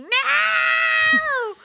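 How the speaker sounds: long, high, loud scream imitating a kid; screamed refusal. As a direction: scream it out, drawn out, mimicking an outraged child. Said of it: "on the other end of the phone screaming".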